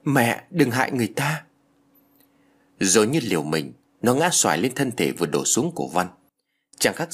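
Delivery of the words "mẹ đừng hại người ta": put-on higher child's voice, mild narrated scream; crying out. A narrator's reading. Girl begging her mother to spare him, crying out. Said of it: "con bé lại thét lên".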